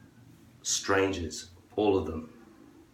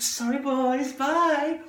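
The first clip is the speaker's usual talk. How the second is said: much higher, breezy mocking farewell; mock girl brush-off; much slower than usual. Girl waves off the guys in a much higher, breezy mocking voice. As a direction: toss off the goodbye sweetly. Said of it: "It's like".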